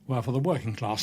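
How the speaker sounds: usual speaking voice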